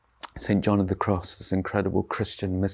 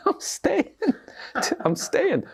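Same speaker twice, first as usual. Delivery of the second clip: high-pitched, laughing mock defiance; laughing refusal; slower than usual. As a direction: act out a gleefully stubborn disciple. Acted disciple stubbornly refusing to go.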